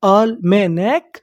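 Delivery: almanac slowly in separate syllables, rising at the end; slow breakdown of almanac. Shown as a demonstration. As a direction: sound out almanac, lifting the last part. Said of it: "तो meaning क्या बनी".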